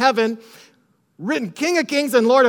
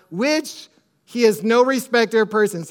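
ordinary talk

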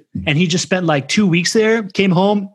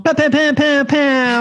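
high, rapid-fire mock fanfare pows; playful "pow" noises; 10 semitones higher, slower than usual. Host announcing the lightning round, playful high pows. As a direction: make your own game-show sound effect.

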